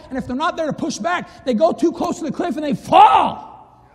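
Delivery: preaching build. Acted. Preacher warning parents about the cliff, rising to a shout. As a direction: warn the congregation, climbing to a shout.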